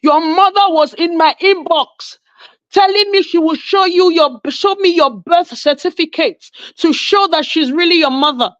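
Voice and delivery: high, mocking quote of the woman; quoting her rant. Woman calling out her man online, mocking high voice. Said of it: "she came out to call him".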